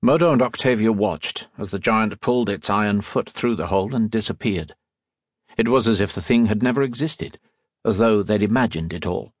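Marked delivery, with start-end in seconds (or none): none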